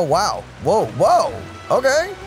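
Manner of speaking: ordinary talk, nothing marked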